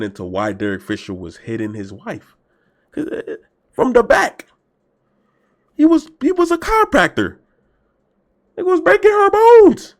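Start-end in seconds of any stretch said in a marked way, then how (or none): 2.9-9.9 can't believe what he's saying; incredulous rising outburst. Real, not acted. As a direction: voice climbing higher and louder with disbelief. Incredulous about wife-beating story.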